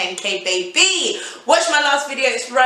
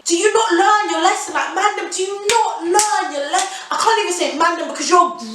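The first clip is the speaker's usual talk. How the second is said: exasperated rant; 7 semitones higher than usual. Real, not acted. Ranting at the man who pestered her.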